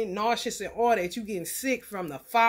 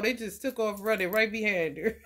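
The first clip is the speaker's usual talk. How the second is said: usual speaking voice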